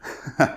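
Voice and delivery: short scornful chuckle imitated; mocking laugh. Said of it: "He laughs".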